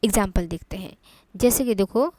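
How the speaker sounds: ordinary delivery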